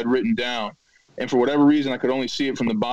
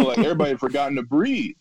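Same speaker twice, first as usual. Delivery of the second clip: usual speaking voice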